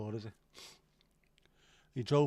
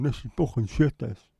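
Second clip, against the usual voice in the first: loud disgusted rant at the telly; fed-up outburst; 10 dB louder than usual. Retold or imitated. A fed-up fan shaking his head at a game, shouting in disgust. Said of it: "shaking my head going".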